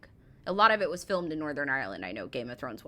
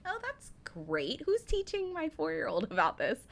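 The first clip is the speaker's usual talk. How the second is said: mock delighted mom; 9 semitones higher than usual. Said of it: "So I was just like".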